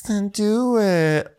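high, slow singsong order; put-on whiny command; mock demanding. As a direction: stretch it out high and insistent. Acted quarrel, demanding reply.

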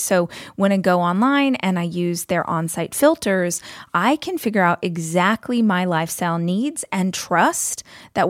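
upbeat sponsor read. Acted. Host pitching a sponsor to listeners, upbeat ad read. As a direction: sell it like a podcast ad.

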